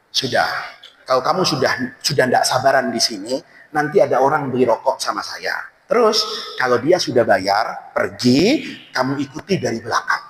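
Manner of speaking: low calm seller. Acted. Seller instructs the imam to follow the buyer, low and calm. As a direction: murmur the plan low and steady.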